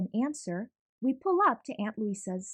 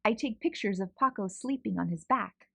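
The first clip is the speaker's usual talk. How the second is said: plain reading voice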